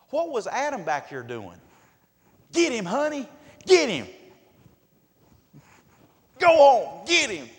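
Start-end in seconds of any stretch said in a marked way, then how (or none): 2.5-4.1 high, very loud egging-on; hammed-up husband urging wife on; cowardly cheering shout. Adam cheering Eve on from behind. 6.4-7.5 high, very loud egging-on; hammed-up husband urging wife on; cowardly cheering shout. Adam cheering Eve on from behind.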